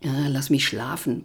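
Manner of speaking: low, sleepy grumble; grumbling. Acted. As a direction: growl it half asleep and annoyed. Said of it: "brummte er". (tief und verschlafen brummend)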